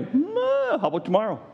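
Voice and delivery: high, dithering hum before answering; hesitant Pharaoh. Acted. Pharaoh stalling when Moses asks, high and dithering. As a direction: stall like a stubborn king.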